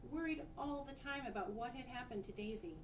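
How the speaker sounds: plain reading voice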